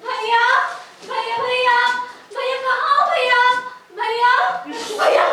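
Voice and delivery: high, loud, excited calls for brother; eager calling. Acted. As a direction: call out gladly for her brother.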